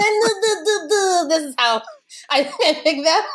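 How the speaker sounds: high, laughing wine snob parody; hammed-up wine snob, giggling through it; sommelier voice. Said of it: "I make that voice".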